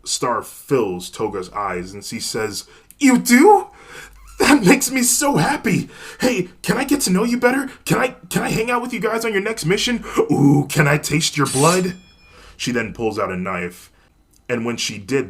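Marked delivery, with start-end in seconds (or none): 3.0-12.0 very high, loud, rushing excitement; thrilled schoolgirl. Acted. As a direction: gush and rush the words. Toga thrilled.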